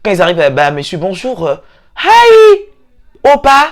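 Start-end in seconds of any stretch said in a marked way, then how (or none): 2.0-3.7 high squeal of surprise; shocked exclamation. Retold or imitated. A friend mock-shocked at an old classmate's new airs. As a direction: cry out in mock shock, much higher.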